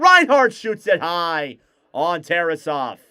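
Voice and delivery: rising hockey excitement; excited call. Acted. Shot on goal call.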